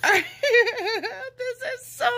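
high, drawn-out gush through laughter; giggly, overjoyed cooing; delighted laughing squeal. Real, not acted. Laughing with delight over doll gift.

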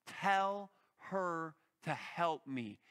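usual speaking voice

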